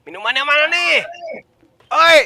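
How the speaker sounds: high, loud yell for the bartender; impatient shouting. Acted. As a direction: shout at the bar, out of patience. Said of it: "Dia ngomong sama diri sendiri gitu".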